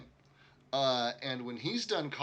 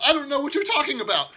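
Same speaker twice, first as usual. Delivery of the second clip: high, loud, rushed protest; loud denial; 13 semitones higher, 11 dB louder, faster than usual. Acted. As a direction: blurt out an over-loud denial. Acted defensive merchant denying smuggling.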